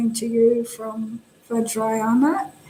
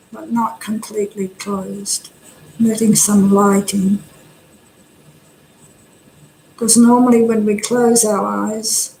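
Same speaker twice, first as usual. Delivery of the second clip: calm body-scan voice, long silences between phrases; guided meditation. Real, not acted. Teacher guiding meditators to relax the face, calm and unhurried. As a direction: guide them gently, leaving space after each instruction.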